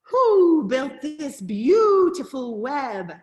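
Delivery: slow, loud hooting question; owl voice. A narrator's reading. Owl asking who built the web, slow and loud. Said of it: "asked the owl".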